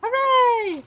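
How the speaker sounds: high, loud hooray; cheering. Acted. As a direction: cheer it out like a kid. Cheering for fruit basket.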